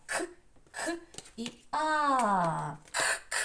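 к as short voiceless bursts, а held long between; grunting к sound. Shown as a demonstration. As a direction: sound out bare «к», then open «а». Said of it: "Кряхтить". (уривчасте «к», протяжне «а»)

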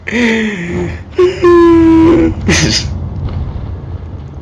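high shrill cackle; shrieking laugh. Real, not acted. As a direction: burst into a piercing laugh.

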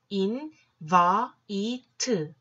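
인, 바, 이, 트 slowly, each separated by a pause; drawn-out separate syllables 인, 바, 이, 트. Shown as a demonstration. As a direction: sound out 인 바 이 트 piece by piece. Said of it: "합치면".

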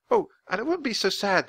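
bright and upbeat; brightly. A narrator's reading. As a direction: say it cheerfully, lifting up. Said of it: "the woman said brightly".

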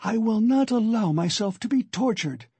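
plain reading voice

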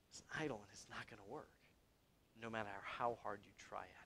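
soft, low, quick, fading to near whisper; hushed verdict. Real, not acted. Preacher concluding on an idol of relationships, hushed verdict. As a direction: drop right down and let it fade. Grave aside concluding the example.

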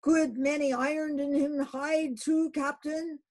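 high, loud broken cry; Queequeg crying out disjointedly. Acted. Queequeg cries to the captain, high and halting. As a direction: stumble over counting the irons. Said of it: "cried Queequeg disjointedly".

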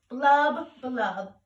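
loud burbling blub blub like a washing machine; washer sound. A narrator's reading. Ant making washer noises at the party. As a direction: bubble it out like a washer churning. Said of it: "just like a washer when it washes clothes".

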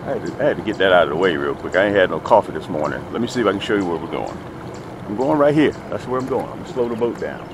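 ordinary delivery

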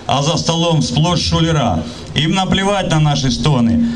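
ordinary talk

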